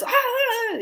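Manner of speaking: high fake-frightened "ah"s; mock panicked cry. Wife springing prank on couch, mock cry. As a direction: cry out high in pretend alarm.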